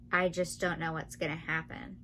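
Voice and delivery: ordinary talk, nothing marked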